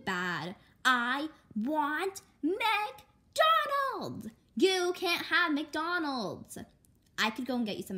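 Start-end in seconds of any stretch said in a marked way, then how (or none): 0.8-6.6 very high, loud, very slow wail; tantrum whine. Acted. Little sister throws a tantrum for McDonald's, wailing. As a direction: stretch every sound.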